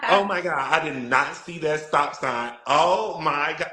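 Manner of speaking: flamboyant plea to a police officer; exaggerated camp innocence. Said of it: "I really gave it up".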